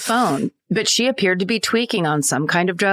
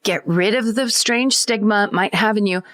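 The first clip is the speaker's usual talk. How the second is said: ordinary talk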